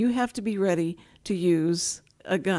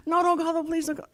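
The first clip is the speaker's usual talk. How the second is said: higher, urgent mild begging; pleading wife; 9 semitones higher, faster than usual. Retold or imitated. Wife begging her not to call the police, urgent and higher. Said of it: "wife begging".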